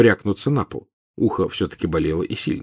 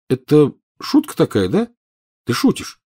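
disbelieving; 7 semitones higher than usual. Acted. Harry disbelieving.

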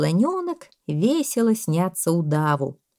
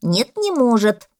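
loud, higher, emphatic denial; contradicting parrot; 5 semitones higher, 7 dB louder, slower than usual. A narrator's reading. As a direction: deny it loudly as the parrot. (попугай, громко и упрямо возражает)

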